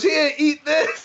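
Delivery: high, drawn-out mock taunt; movie character taunt. Film character forcing a sandwich on someone, hammed-up taunt. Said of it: "and he goes".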